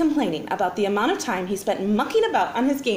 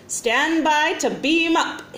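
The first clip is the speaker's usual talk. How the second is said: commanding; 6 semitones higher, slower than usual. A narrator's reading. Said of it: "he commanded".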